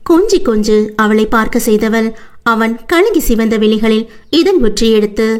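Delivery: ordinary delivery, nothing marked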